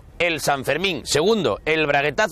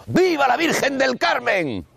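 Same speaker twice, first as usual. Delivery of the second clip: crowd cheer; 8 semitones higher than usual. Retold or imitated. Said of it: "marineros gritando".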